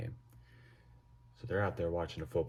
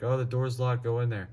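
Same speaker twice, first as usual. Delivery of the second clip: louder, higher voice of a mom; imitated mom calling out; 5 semitones higher, 7 dB louder than usual. Mom at the front door to the boys, imitated calling out. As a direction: mildly mimic a mother calling out, pitched up.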